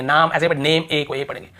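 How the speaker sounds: ordinary talk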